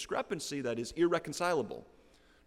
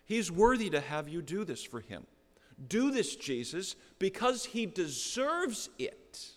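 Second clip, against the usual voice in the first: rising, insistent plea to Jesus, higher at the end; pleading elders; 6 semitones higher, 4 dB louder than usual. Retold or imitated. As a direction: press Jesus hard, as the elders would. Elders pleading on centurion's behalf.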